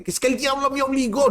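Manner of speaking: high, mocking whiny excuse voice. Preacher mocks a churchgoer's excuse, in a high whiny voice. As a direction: parody someone blaming the devil.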